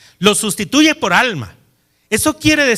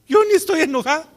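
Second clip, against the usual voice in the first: higher, fast, hammed-up protest; mock indignant denial; 8 semitones higher, much faster than usual. Preacher mimicking the sulking husband denying he is angry, mock squeak. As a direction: toss off the denial in a mocking squeak.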